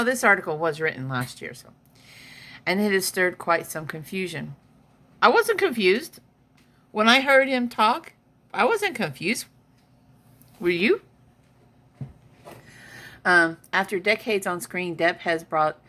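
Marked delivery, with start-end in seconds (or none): 5.2-11.0 high, loud, emphatic disbelief; incredulous scoff. Real, not acted. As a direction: scoff at the very idea. Mocking the confused fans.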